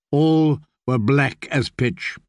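ordinary delivery

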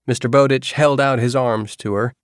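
usual speaking voice

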